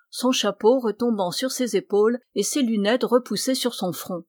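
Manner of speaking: ordinary delivery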